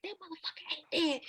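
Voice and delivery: hushed, whispery curse; retold cursing. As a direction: imitate mom cussing under her breath. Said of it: "she was cussed like".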